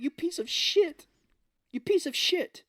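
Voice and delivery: a hammed-up angry heroine; mock angry insult. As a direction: spit the insult out in a squeaky mock fury. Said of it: "Korra calls him a piece of shit".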